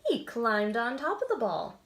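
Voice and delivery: lively storybook read-aloud with voices; animated picture-book narration; reading to children. A narrator's reading. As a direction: read it like bedtime story time.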